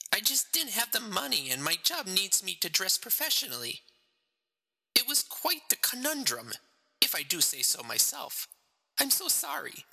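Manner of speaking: a narrator voicing a crying woman at confession; weeping woman. A narrator's reading. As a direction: voice lifted high and near tears. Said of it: "She was weeping".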